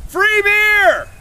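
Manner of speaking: very high, loud, long-drawn call; barker's shout. Pretend. Performer luring passers-by with free beer, drawn-out shout. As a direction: holler it as bait for a crowd.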